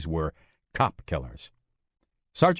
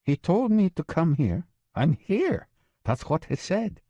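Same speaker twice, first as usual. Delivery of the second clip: Maria's insistent voice; 9 semitones higher than usual. Acted.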